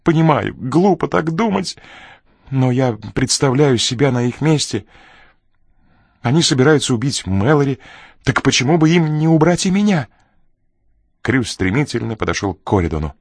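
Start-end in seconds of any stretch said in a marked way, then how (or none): 0.0-10.1 high, anxious, insistent voice; agitated fear. A narrator's reading. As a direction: argue fearfully, pitched up. Frightened man explains his fear of murder.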